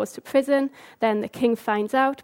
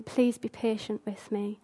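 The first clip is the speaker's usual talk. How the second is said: much softer, humble begging; soft plea; 2 semitones lower, 8 dB quieter than usual. Retold or imitated. Sinner asking Jesus for patience, quietly pleading. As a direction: ask gently, almost under the breath.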